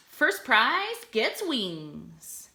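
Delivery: very slow, weighty last line; slow reveal to end the poem; slow dramatic finish. Acted. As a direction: land the punchline slowly. Poem's final line.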